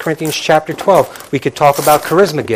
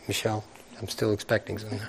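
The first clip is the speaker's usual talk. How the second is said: laughing aside; 4 semitones lower, 13 dB quieter, much faster than usual. Real, not acted. Joking aside about Christmas gifts.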